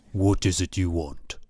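low, subdued character voice for the owner; gallery owner. A narrator's reading. Gallery owner confronting an unwelcome visitor, low-voiced. Owner repeats his challenge.

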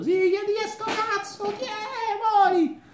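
very high, sing-song dwarf voice, cackling; tiny dwarf taunt. Acted. Caged dwarf jeering at passersby in a very high sing-song voice. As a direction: squeal it like a nasty little gnome.

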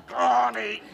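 very high, loud bird-like shriek of a name; a mynah bird calling the wife; parrot squawk. Retold or imitated. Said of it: "it now imitates".

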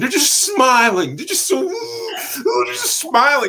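"smiling" stretched out high and loud; exaggerated emphasis. Actor describing grinning producers, "smiling" drawn out loudly. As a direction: draw out "smiling" in mock wonder.

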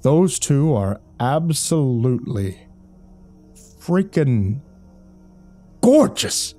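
slow build to a high burst; linger, then burst out on "gorgeous"; smitten, with long dramatic halts; awestruck gushing. A narrator's reading. Jerry gushes over the departing couple.

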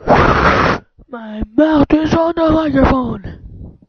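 slow, booming shout into the microphone; very loud and drawn-out; loud mouth-on-mic call. Pretend. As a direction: stretch it out loud, right up on the mic. Calls out into the microphone.

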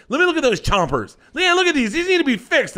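high, insistent lunch-table voice; mock fussing. Lunch guests inspect his teeth, high mock fussing. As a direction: imitate someone fussing, high-pitched and pushy.

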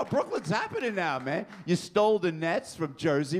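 ordinary talk

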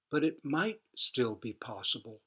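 ordinary delivery, nothing marked

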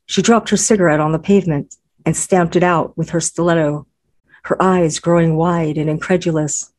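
plain reading voice

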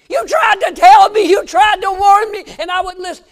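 loud, high broken cry; anguished cry. Retold or imitated. Grieving father crying out to the pastor, loud and high. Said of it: "he looked up and he said".

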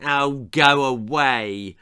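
slow, drawn-out bratty screech; mild screech. A narrator's reading. Beehive daughter dismisses her parents, screeching slowly. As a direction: drag out each word in exasperation. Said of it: "screeched their beehive daughter".

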